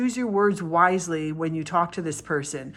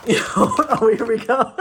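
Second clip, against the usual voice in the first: words through real laughter, higher and louder; laughing; 5 semitones higher, 7 dB louder than usual. Real, not acted. Reader cracking up at her own blunt joke, laughing.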